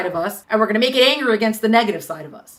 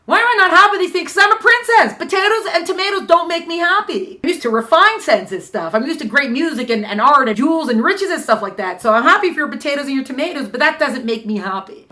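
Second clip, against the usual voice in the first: complaining princess; 5 semitones higher, 4 dB louder than usual. Acted. Princess explains her unhappiness.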